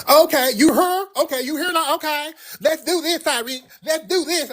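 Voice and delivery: very high whiny challenge; put-on indignant squeal of a voice; mock sassy. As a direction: imitate a sassy dude squaring up. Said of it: "and he was all sassy".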